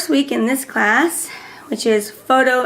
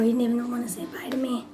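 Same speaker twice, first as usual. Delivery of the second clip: quick whisper with a worn-out voice; whispering because her voice is gone; lost voice; 9 dB quieter, faster than usual. Real, not acted. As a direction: rush it out in a whisper. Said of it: "my voice is messed up".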